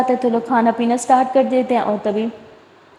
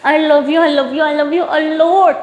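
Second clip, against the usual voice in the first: gushing love; 4 semitones higher, much slower than usual. A narrator's reading.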